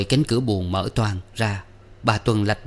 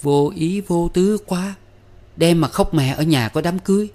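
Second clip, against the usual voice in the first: snide; 6 semitones higher, 3 dB louder than usual. A narrator's reading. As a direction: needle her with mock sweetness. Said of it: "nói giọng mát mẻ".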